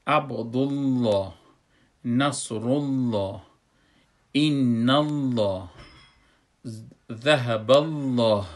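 loud, drawn-out Arabic with a heavy Lloh; measured Quranic demo, rounding out Allah; full, heavy Allah. Shown as a demonstration. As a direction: recite slowly with a thick, dark L in Allah.

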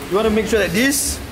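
ordinary delivery

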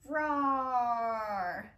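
loud roaring lion growl. Shown as a demonstration. Teacher demonstrating a lion roar for kids. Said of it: "Let it out when you're ready".

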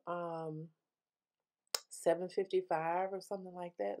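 mouthpiece between lips; teeth-whitening tray in mouth; talking with tray in mouth. Real, not acted. Seen 0: Glowing whitening tray held in mouth, cable hanging, throughout the words.

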